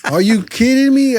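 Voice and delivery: high, loud, laughing disbelief. Retold or imitated. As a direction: burst out laughing at the lucky coincidence. Buyer names the same price.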